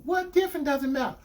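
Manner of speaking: retold girlfriend. Girlfriend dodging his question, imitated defensively. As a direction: imitate her brushing the question off. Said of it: "She said".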